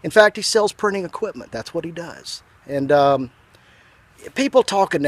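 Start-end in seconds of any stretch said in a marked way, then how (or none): none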